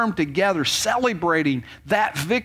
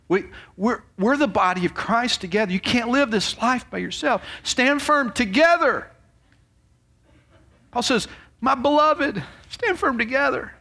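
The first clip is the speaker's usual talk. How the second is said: preaching; 7 semitones higher than usual. Acted. Preacher exhorting the congregation.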